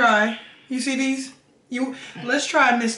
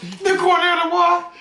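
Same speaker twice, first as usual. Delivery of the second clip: incredulous singsong; 7 semitones higher, 3 dB louder than usual. Real, not acted.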